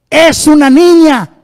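loud, high, slow proclamation; announcing. Acted. Preacher booms the doctor's news, loud and slow. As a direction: ring it out to the hall.

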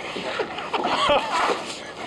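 breathless high-pitched giggle; a giddy, airy laugh. Real, not acted. Laughing at the camera while fighting a fish, high giggle.

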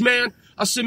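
normal talking voice

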